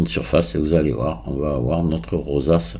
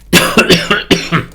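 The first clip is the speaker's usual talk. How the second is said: harsh dry cough; a real hacking cough; 11 semitones higher, 6 dB louder than usual. Real, not acted. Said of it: "je tousse toujours beaucoup".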